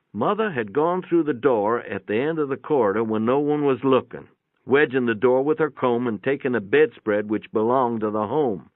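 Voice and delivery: usual speaking voice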